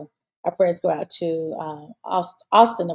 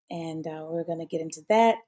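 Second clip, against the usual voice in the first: usual speaking voice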